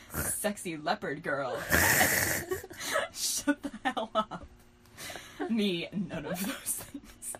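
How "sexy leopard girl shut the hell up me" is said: ordinary talk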